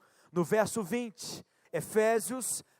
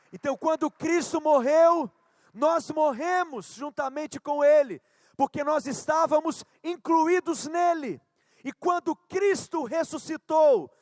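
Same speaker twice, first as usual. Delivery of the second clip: fervent preaching; 5 semitones higher, 5 dB louder than usual. Acted. Preaching at full force.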